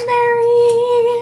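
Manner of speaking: high loud call of a name. Real, not acted. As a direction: call her name loudly. Calling her friend by name.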